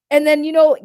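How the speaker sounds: ordinary delivery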